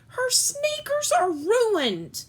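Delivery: high, slow dismayed mother; mother's dismay. Acted. Mother lamenting to dad, high and slow. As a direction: wail it out like a mother in despair. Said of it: "my mother said".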